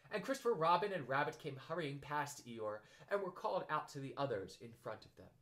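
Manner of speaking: plain reading voice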